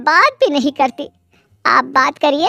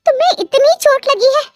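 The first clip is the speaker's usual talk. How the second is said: shocked gasp; 11 semitones higher than usual. A narrator's reading. Gasping neighbour sees injury.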